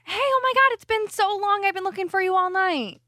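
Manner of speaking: put-on high, bright surprise to see an old friend; fake excited greeting. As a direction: gush like you've spotted a long-lost friend. Acting out rescuing a woman.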